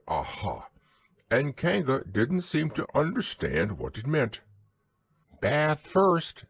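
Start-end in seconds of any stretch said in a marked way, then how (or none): 0.1-0.7 weak aha. A narrator's reading. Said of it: "it wasn't a very good". 5.4-6.4 cheerful. A narrator's reading. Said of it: "in a cheerful voice".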